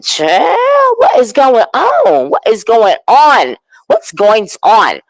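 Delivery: high, drawn-out disbelief; scandalized, slow retold exclamation; baffled reaction. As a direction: stretch each question out in mock bewilderment. Acts out reaction to the drama.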